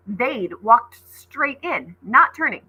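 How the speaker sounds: usual speaking voice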